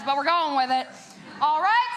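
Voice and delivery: ordinary delivery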